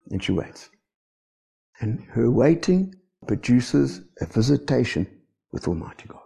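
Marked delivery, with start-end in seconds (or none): 5.5-6.2 awed whisper. Real, not acted. Preacher telling of meeting God, reverent whisper. Preacher hushes at God's visitation.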